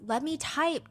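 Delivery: normal talking voice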